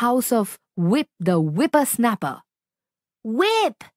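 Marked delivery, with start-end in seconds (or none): 3.2-3.8 high urgent call of a name; cried out. Acted. Zap calling to Whip in alarm, high urgent cry. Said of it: "he cried".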